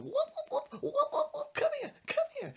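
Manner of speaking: very high whooping grandma; high laughing mock grandma whoops; grandma war cry. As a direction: whoop while twirling like a mad granny. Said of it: "starts twirling around going".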